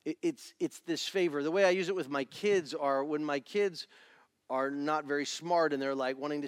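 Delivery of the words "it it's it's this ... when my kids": usual speaking voice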